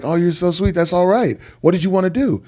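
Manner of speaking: high, gushing imitation of himself; playfully high sweet-talk; mock sweet reply. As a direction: lift the voice high and sugary. Retelling his sweet reply to fan.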